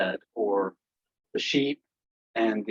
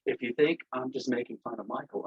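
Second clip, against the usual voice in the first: usual speaking voice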